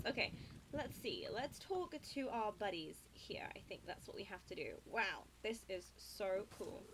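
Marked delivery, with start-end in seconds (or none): none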